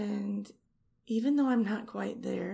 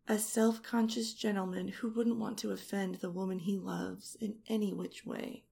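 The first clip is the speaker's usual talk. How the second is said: ordinary delivery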